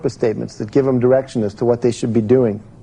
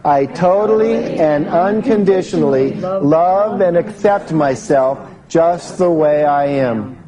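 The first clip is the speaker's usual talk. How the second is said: x0.6 slow, every word weighted; drawn out so the room can follow; leading the room through the affirmation again slowly; slow heartfelt affirmation; 6 semitones higher, slower than usual. Shown as a demonstration.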